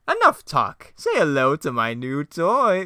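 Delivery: high, loud, slow villain boast; Joker voice. Acted. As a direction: show off your weapon gleefully. Joker reveals steamroller.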